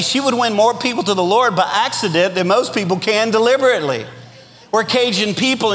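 ordinary talk

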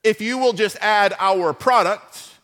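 slow, loud salesman pitch; hammed-up sales voice selling the formula; infomercial pitch. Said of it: "the formula they use to try to sell you stuff".

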